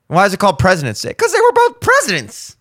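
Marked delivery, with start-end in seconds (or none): 1.2-2.6 pitched way up, dopey and mocking; silly high mocking voice. Comic mocks officials with a squeaky high dumb voice.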